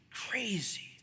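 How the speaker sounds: hushed whisper of wonder; whispered aside about the Red Sea parting; awed whisper. Real, not acted. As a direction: breathe the word out in awe.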